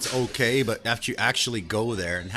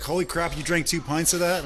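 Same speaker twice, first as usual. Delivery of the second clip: a higher, mock-stunned disbelief; higher retold gasp of surprise; shocked friend; 7 semitones higher than usual. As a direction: act out a friend who can't believe it. Friend shocked by drink count.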